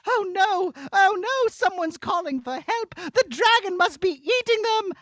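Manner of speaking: high princess voice, worried; a fretful storybook princess. Acted. Princess hearing cries, worried for the trapped one.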